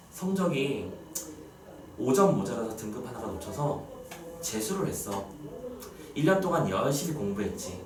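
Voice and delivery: quiet, low storytelling with long pauses; subdued, sunken sadness. Acted. Teacher tells students of a failed exam retaker, subdued and sad. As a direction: sigh softly through a calm, resigned sorrow. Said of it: "가라앉아 버린 듯한 느낌의 슬픔이야".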